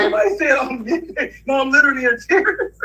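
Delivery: high, breaking into real laughter; crying with laughter. Real, not acted. Speaker losing it over [name] mid-joke, crying with laughter. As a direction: squeeze the words out through laughing. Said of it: "I'm about to start crying laughing".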